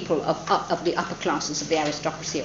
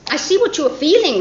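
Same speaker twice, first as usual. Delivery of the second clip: Charlotte's voice; 9 semitones higher, 7 dB louder than usual. Acted. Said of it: "Charlotte says".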